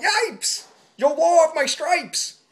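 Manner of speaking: high, loud alarmed yelp; yelling. Acted. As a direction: yelp it in panic. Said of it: "The zebra yelled".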